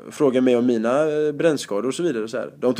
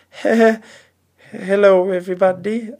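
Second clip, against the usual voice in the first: nervous laugh; 6 semitones higher than usual. Retold or imitated. Himself nervously starting set.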